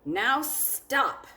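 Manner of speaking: loud bossy "now stop"; firm order. Acted. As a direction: bring the party to a halt. Max ends the rumpus.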